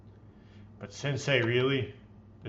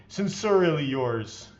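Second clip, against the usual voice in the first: normal talking voice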